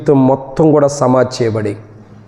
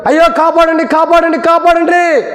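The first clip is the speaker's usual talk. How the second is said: mock cry for help; 13 semitones higher than usual. Drowning man cries for help.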